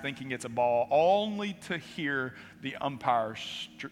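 usual speaking voice

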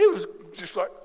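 ordinary talk, nothing marked

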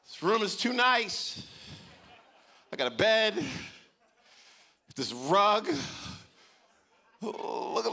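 high, hammed-up blubbering kid; a child crying that the room is too nice; sobbing child. Parent voices crying child.

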